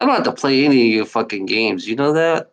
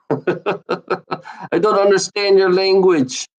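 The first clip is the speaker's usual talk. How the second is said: slow, laughing put-on; mock them through a laugh; slow giggling mockery; 5 semitones higher, much slower than usual. Shown as a demonstration. Mocking with laugh.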